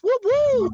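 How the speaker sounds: high, hyped-up rally MC; rally opener hype. Pretend. Opening the Trump rally for the crowd, high hype MC. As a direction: work the crowd like a warm-up act.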